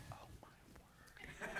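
barely audible whisper; slow whisper under the breath, acting put-upon on the phone; exasperated aside. Acting silent exasperation at phone call.

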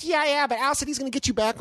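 a hint of a chirpy stranger; girl's voice. Stranger girl explains to him, light chirpy voice. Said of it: "She goes".

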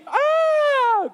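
very high shrieking scream; panicked scream. Retold or imitated. Boy dropping his date to flee the chainsaw, shrieking. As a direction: shriek in terror before running. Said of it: "I scream".

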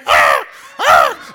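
loud low squawking caw; crow caw. Retold or imitated. Pastor imitating the crow he hears through the window, loud caw. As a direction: caw like the blackbird outside. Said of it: "And I can hear".

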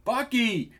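high-pitched alarmed cry; startled, high exclamation; gasped. A narrator's reading. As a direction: call the name in a high gasp of alarm. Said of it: "gasped Muriel".

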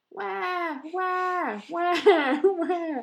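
high, loud mock baby crying; hammed-up infant crying wah wah; baby wail. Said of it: "the baby yelled".

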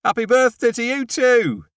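high, loud shout across the road; shouting back to the neighbour across the road. A narrator's reading. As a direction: belt it out to her. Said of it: "so I shout".

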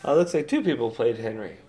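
ordinary talk, nothing marked

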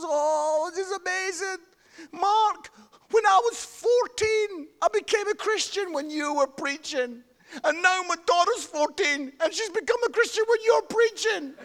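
high-pitched, gushing mock-emotional mother; imitated tearful mum. Mum thanking the preacher who converted her, imitated tearful gushing. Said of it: "the mum comes up and she's crying".

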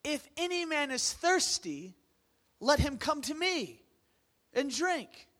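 high, loud crying out. Acted. Jesus standing up at the festival, loud cry. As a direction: cry out across the temple. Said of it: "stands up with a loud voice and goes".

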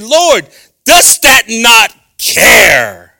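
slow, loud, high complaint; indignant shout. Acted. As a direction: drag it out like a wounded cry of protest. Martha complaining to Jesus.

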